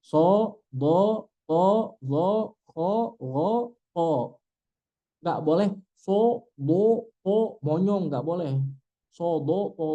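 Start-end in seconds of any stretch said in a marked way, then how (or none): 0.0-4.4 "So, do, to" with rounded lips only as far as o; thick letters to o. Shown as a demonstration. Teacher showing the heavy letters. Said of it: "Hanya sampai di o saja".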